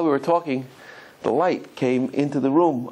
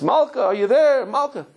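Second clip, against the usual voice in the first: calling louder into a silent phone; hammed-up worried yell down the line; mock phone shouting; 8 semitones higher, 3 dB louder than usual. Groom shouting for his bride on the phone, mock loud calling. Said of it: "He's shouting on the phone".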